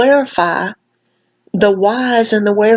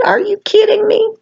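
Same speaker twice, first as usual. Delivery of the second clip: disbelieving, pitched way up; incredulous; 13 semitones higher than usual. Real, not acted. Vlogger reacting to a girl's video, stunned disbelief. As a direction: she can't believe what she just heard.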